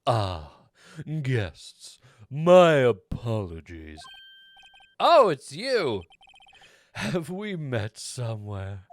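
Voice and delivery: bow and apologise like a hotel bellboy; hint of a courteous bellboy, slow and low. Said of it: "What was this guy's voice again?".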